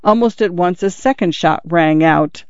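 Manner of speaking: ordinary delivery, nothing marked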